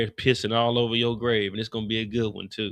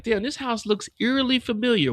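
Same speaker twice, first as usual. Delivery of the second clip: much higher, mock spooked; high mock wonder; 10 semitones higher than usual. Rock pulls up, high and spooked at the house. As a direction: play it high and wary. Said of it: "I'm like".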